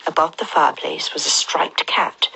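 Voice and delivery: ordinary delivery, nothing marked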